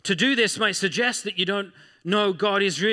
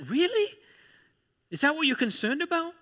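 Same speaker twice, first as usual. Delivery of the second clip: incredulous; 11 semitones higher than usual. Real, not acted. Preacher questioning doubt incredulously.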